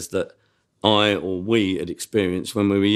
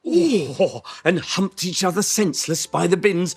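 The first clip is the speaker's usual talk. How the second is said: leer at the customer, pitched up; high leering tailor; 8 semitones higher than usual. Acted. Tailor teasing a customer about his night, high and leering. Riffing in sketch character.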